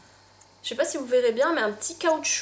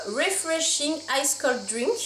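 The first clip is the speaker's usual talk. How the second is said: slow English with a French accent; poor English accent; 2 semitones higher, slower than usual. Real, not acted. Vlogger reading the writing on a pink glass, French-accented English. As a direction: read the English carefully, in a clumsy French accent. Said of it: "désolée pour mon accent anglais".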